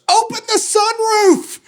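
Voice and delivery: high, drawn-out yell; mock shout of a text in huge letters. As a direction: belt it like a joke shout. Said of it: "sends me this text in huge letters".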